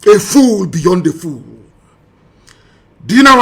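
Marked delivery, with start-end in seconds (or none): none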